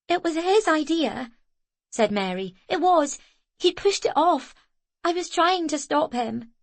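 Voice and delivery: put-on a frightened child protesting; defensive girl. A narrator's reading. Girl insisting to Zoe she tried.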